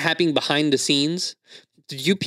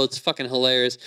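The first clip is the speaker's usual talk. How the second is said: ordinary delivery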